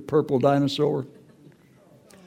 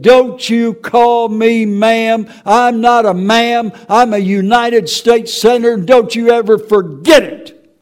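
higher, louder, slow, indignant mock senator; imitating a senator's angry rebuke; 7 semitones higher, 9 dB louder than usual. Senator snapping at a Capitol officer, mock indignant rebuke. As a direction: scold him like an offended senator, drawn out. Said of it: "And she said".

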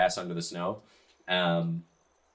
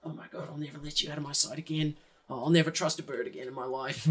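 hushed, higher, tender apology; soft, fervent vow under the breath; whispering to a book; 6 semitones higher, 6 dB quieter, faster than usual. Acted. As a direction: murmur remorsefully to something treasured. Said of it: "like, whispering to the book".

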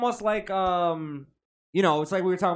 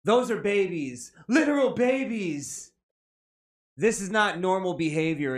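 mock outrage; 5 semitones higher than usual. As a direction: read it like an appalled commenter, drawing out the words. Reads an outraged online comment aloud.

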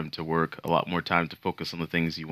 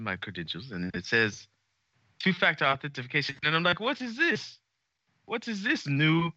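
higher-pitched mock South African accent; a loose South African accent, pitched up, telling a story; Noah South African impression; 6 semitones higher than usual. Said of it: "So it'd be like".

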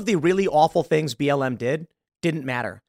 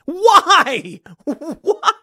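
laughing disbelief; 12 semitones higher, 6 dB louder than usual. Real, not acted.

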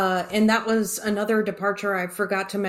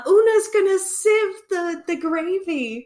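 much higher, put-on fussy mother voice; fussy mother impression; 9 semitones higher, slower than usual. Mother covering up with guests, high put-on fussy voice. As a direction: chirp it like a flustered hostess. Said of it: "And she's like".

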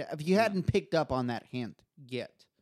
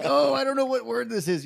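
a higher, put-on clueless whine; mock helpless voice; 9 semitones higher, 3 dB louder than usual. To friends, mocking his own ESL excuse in a higher whine. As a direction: act like you can't find the word. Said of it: "It's like".

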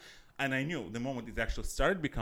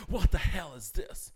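mock upset woman; 4 semitones higher, 4 dB louder than usual. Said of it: "she gets very upset".

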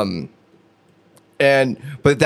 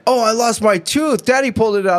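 put-on little kid voice; 7 semitones higher than usual. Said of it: "he's like".

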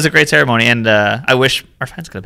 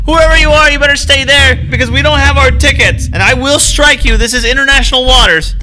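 high, loud hammed-up warning; mock threatening; 12 semitones higher, 4 dB louder than usual. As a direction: bluff a threat at a stranger. Threatening an unseen figure.

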